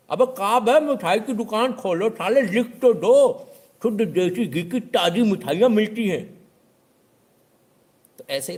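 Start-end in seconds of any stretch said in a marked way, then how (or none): 0.1-6.5 much higher, louder, slow scornful voice; know-it-all yelling to write the full board back; mock exasperated yell. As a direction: stretch every word in fed-up mock rage. Customer demanding words written again.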